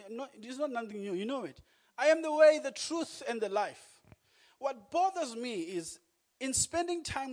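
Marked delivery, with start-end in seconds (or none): 2.0-4.0 loud, high peak on the first words; proclaimed verse. Acted. Preacher declaring Jesus' answer to the congregation, loudly. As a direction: ring it out as the climax.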